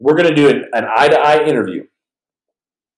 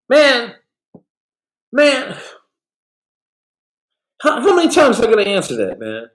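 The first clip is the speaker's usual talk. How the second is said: exasperated; 11 semitones higher than usual. Real, not acted. Exasperated at repeated question.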